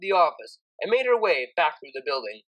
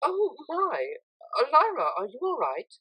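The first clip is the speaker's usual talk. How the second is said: higher, showy worried tone; mock worry to cover snooping; put-on concern; 9 semitones higher than usual. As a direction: act concerned to change subject. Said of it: "put a concerned look on".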